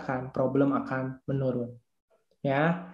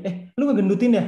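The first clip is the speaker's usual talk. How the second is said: higher, louder mock jab; mock put-down; 4 semitones higher, 5 dB louder, faster than usual. Fault-finder greeting a friend about weight, mock disparaging. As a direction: imitate a tactless fault-finder. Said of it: "Nah, langsung ngejelekin".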